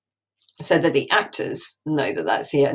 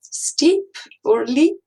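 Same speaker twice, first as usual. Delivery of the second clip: mock Scottish accent, much higher and slow; deliberately bad Scottish accent, drawn out; 11 semitones higher, 4 dB louder, much slower than usual. Shown as a demonstration. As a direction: ham up a dodgy Scottish accent. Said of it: "create a really bad Scottish accent".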